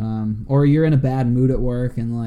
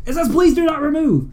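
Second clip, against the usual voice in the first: exasperated. Retold or imitated. Exasperated clerk quoting card reader.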